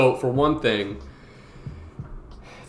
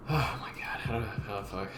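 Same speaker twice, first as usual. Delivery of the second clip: breathless whisper under the breath; 6 semitones lower, 12 dB quieter than usual. Real, not acted. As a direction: mutter it almost to nothing. Struggling quietly after a fall.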